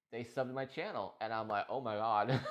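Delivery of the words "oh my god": a real laugh of happy disbelief; overjoyed laugh. Real, not acted. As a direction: burst out laughing with joy. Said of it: "it tears me up, it makes me emotional".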